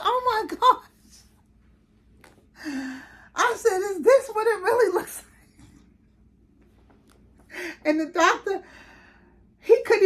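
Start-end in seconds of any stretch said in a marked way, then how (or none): 0.0-7.7 much higher, louder gasped wonder; astonished watching a birth; awed amazement. Retold or imitated.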